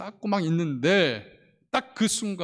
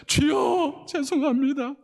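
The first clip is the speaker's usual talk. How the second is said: 울먹임; 10 semitones higher, 3 dB louder, slower than usual. Retold or imitated. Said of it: "혼자서 울고 울고".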